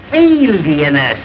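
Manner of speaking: drags alienist out slowly; slow correction. Acted. Spells out the word slowly.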